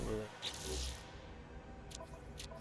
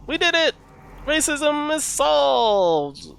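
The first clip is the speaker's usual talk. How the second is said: high, loud mocking cheer, much higher; mock triumphant politician; 14 semitones higher, 7 dB louder, slower than usual. Politicians cheering after the verdict, mock triumphant shout. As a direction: parody politicians cheering a hollow victory. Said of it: "so eager to just say".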